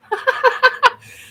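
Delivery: high burst of real laughter; a real laugh at the own joke. Real, not acted.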